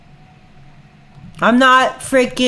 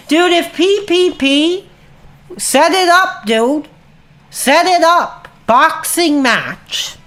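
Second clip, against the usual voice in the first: egging the rival on to fight; high, heated call to the ring; fired-up challenge; 7 semitones higher, slower than usual. Real, not acted.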